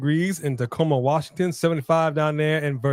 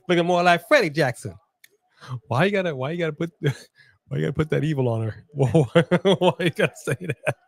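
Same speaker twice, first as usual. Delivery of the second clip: talking through laughter; laughing; 2 semitones higher than usual. Real, not acted. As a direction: giggle through the words. Laughing while teasing.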